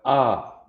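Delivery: "ah" as an open, falling vowel; primary vowel sound. Shown as a demonstration. Teacher giving the correct vowel, open "ah". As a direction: say "ah" open and settled. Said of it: "That's our primary vowel sound".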